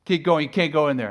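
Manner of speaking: firm loud traffic cop; cop's stern order. Retold or imitated. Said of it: "it says".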